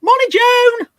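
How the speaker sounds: high, loud cheery holler; calling out a greeting. Real, not acted. Host hailing a viewer they know, loud high call. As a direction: sing out the greeting at full volume.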